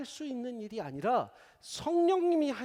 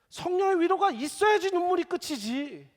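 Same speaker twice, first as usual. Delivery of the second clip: high, loud preacher's cry; fervent preaching; 11 semitones higher, 8 dB louder than usual. Acted. Preacher insisting mourners need the Spirit's comfort, crying it out loud. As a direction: preach it out with full force.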